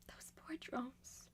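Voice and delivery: a whispered lament for the poor drones; soft grieving whisper; sad whisper. Acted. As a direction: breathe it out, barely there. Mourning the killed drones.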